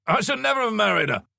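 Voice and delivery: high, loud, hearty declaration; jovial ex-husband. A narrator's reading. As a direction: roar it out as a great joke. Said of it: "roared with laughter".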